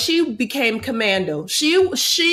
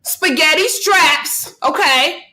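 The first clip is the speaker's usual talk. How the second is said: excited gushing; 4 semitones higher, 3 dB louder, much slower than usual. Real, not acted. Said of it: "Why are you yelling at me here?".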